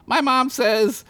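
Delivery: put-on high little girl voice; young girl. Squeak quoting her mom, in a high girlish voice. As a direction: pitch up like a small girl. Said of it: "and I quote".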